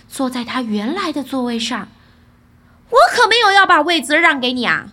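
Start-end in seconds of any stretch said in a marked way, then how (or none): 2.9-4.9 loud, quick protest; 大声嚷嚷. A narrator's reading. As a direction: shout it fast, refusing to give up the seat. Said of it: "儿子大声嚷嚷". (又快又响地嚷嚷)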